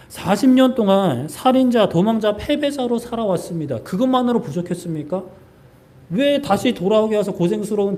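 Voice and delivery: preacher voicing Moses' bitter outcry to God; protesting to God. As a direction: argue with God, voice rising in grievance. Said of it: "하나님 앞에 따지는 거예요".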